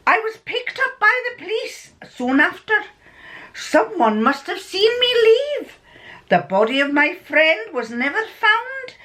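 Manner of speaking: high-pitched mock Scottish storytelling; amateur Scottish with a bit of Irish; terrible Scottish accent. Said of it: "My Scottish accent's terrible".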